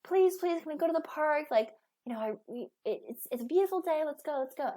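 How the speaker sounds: put-on high, pleading kid voice; begging kids. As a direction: plead like an eager child wanting the park. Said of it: "they come up to you and they're like".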